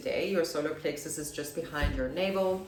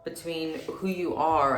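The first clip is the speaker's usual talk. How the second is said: saying while writing; slower than usual. Real, not acted. Noting down her idea mid-video, slow and absent. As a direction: dictate it slowly to yourself as you write.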